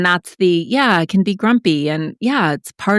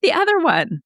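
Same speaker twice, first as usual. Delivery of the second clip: much higher, snide mock-reviewer voice; mocking a hater. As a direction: sneer it like a nasty online comment. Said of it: "people were like".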